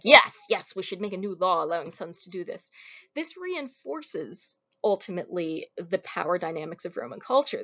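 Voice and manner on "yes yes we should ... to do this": louder cheering yes, then fast; hammed-up eager approval; mock enthusiasm. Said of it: "supposed to be like".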